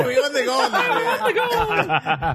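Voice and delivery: put-on high Irish leprechaun accent through laughter; laughing Irish leprechaun voice. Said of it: "Iranians sound like leprechauns".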